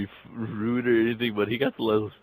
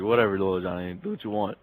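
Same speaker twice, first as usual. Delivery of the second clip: usual speaking voice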